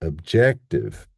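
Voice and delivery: "objective" slow, with a strong JEC; stress on second syllable. Shown as a demonstration. Teacher contrasting two words, slow second-syllable stress on "objective". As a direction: reduce "ob" to schwa, hit JEC in "objective". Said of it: "you can hear that difference".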